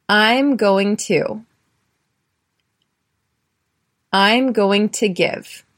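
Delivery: slow, clear, word by word; slow teacher drill; slow repeat-after-me model. Shown as a demonstration. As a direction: model the phrase slowly for learners. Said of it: "Repeat after me".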